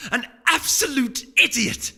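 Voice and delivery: high, loud, slow furious cry; angry outburst. Acted. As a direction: spit out the insult in a rage. Celerity ranting about Blue Blood.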